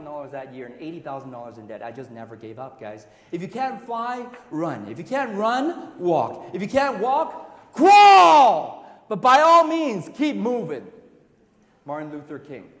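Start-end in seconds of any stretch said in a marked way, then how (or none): 3.3-10.8 slow build to a loud high roar; booming motivational speech. Real, not acted. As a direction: build each line until crawl explodes. Building quote to climax.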